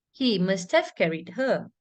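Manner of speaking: teacher showing the full-stop drop; falling tone at full stop. Shown as a demonstration. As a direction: rise then fall on her, then stop. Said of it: "Again, goes up, comes down and stops".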